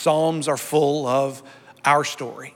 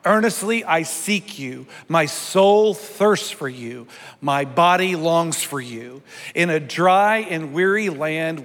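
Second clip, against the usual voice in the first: raised, proclaiming prayer; leading congregational psalm reading; 3 semitones higher, 3 dB louder than usual. Acted. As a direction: declare the psalm out loud for the church to follow.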